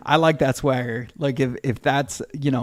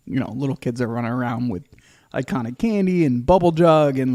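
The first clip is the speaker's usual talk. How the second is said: ordinary delivery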